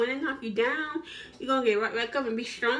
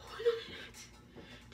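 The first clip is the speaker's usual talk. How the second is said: whispered with a little laugh; 12 semitones higher, 11 dB quieter, slower than usual. Shown as a demonstration. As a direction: giggle it under your breath. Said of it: "Just say that to yourself".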